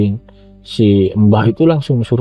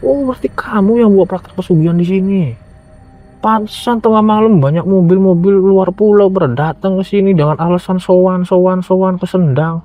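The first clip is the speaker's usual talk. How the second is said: accusing village head; 8 semitones higher than usual. Retold or imitated. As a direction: point the finger and accuse him. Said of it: "Kalau ke bahasa sininya mah".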